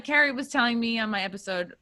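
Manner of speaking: normal talking voice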